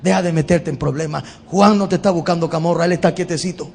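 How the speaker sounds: calm him down lovingly; loving patience. Pretend. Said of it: "Jesús con amor y paciencia le dice".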